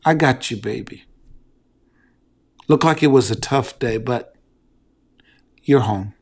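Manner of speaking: soft, low, gentle comfort to a tired wife; tender reassurance. Shown as a demonstration. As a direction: hush it down and soothe her. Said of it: "just say the simple words".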